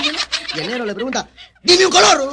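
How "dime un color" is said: loud, high bullying demand; acted shouted demand. Ex-convict demanding a color from crying Jaimito, acted shout.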